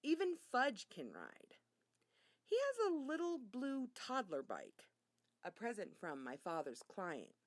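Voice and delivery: usual speaking voice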